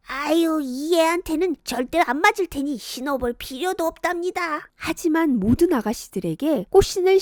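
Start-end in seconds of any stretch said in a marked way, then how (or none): 0.1-4.7 higher, softer wheedling voice; scheming stepmother. Acted. Stepmother brushing off the official, higher wheedling voice. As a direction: fob off the official sweetly. Stepmother pushes Kongjwi away.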